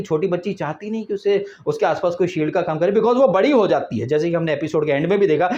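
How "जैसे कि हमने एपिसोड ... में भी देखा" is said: ordinary talk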